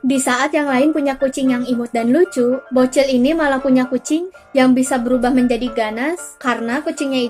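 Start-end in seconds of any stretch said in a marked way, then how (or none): none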